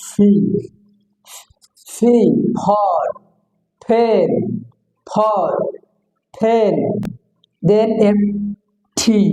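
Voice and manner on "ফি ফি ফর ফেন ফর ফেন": "ফি", "ফর", "ফেন" with a puffed p; aspirated P. Shown as a demonstration. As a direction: burst air on the first sound of "ফি", "ফর", "ফেন". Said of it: "Foy দীর্ঘিকার".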